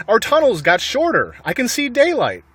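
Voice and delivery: high, eager small fox; bright excited cub voice; excited little fox. A narrator's reading. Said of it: "said one of the small foxes".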